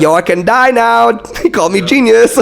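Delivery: high, laughing elation; giddy with joy. Real, not acted. Recalling [name]'s praise, giddy and high. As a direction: squeal it out, overjoyed. [name] called him genius.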